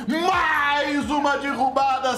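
high, slow announcer call; drawn-out announcer shout. Real, not acted. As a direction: stretch it like a ring announcer.

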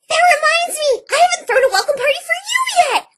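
high, loud gasp into dismay; horrified at forgetting the party; sudden gasp. Acted. Party pony realizing she forgot the welcome party, gasping.

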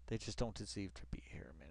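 fast, breathy whisper; whispered aside. Real, not acted. Streamer grumbling about the guys, quick whisper. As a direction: hiss it under your breath.

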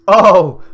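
sudden high, booming outcry; loud "oh!". Real, not acted. Gamer reacting to a sudden chance, loud outcry. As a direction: burst out in shock.

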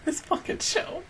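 whispered exasperation; real whisper. Real, not acted. Fan whispering at a devastating episode. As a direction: breathe it out unvoiced. Overwhelmed viewer.

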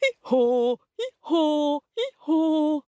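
high donkey hee-haw; donkey bray. Acted. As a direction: bray proudly like a lead singer. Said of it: "I'll be the lead singer".

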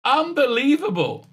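high, disbelieving cry of really; can't believe what she's seeing; sharp exasperated disbelief; incredulous. Real, not acted. Disbelief at the screen.

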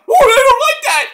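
high hammed-up wrestler whine; Mick Foley impression. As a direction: squeal it like a panicked Mick Foley. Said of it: "Right, Mick?".

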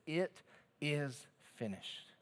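very soft, slow, spaced-out words; hushed last words. Acted. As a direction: let each word fall quietly, with long gaps. Jesus's last words on cross.

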